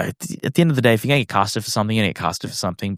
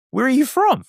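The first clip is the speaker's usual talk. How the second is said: mock question. Indians asking where he's from.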